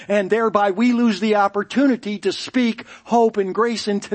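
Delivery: full-force preaching, building to a cry. Real, not acted. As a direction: drive the point home to the congregation.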